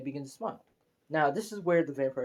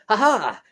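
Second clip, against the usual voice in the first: mock villain laugh; 7 semitones higher, 8 dB louder than usual. Skeleton bursts in with a whip.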